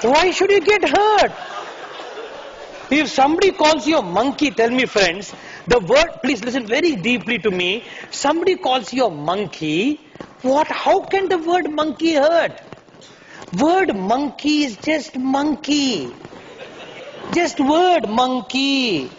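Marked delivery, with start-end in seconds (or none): 0.0-1.3 higher and louder, pressing the audience; a preacher's rising challenge to the hall; emphatic challenge. Real, not acted. 13.6-19.1 very slow, word by word; a teacher slowing right down to drive the point home; slow deliberate emphasis. Shown as a demonstration.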